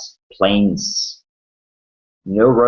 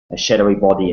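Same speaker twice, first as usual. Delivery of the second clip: normal talking voice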